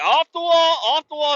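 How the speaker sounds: high, loud repeated order; mock lifeguard shout. Lifeguards shouting at dad again, mock shout. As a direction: yell it like a bossy teen. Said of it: "And they're like".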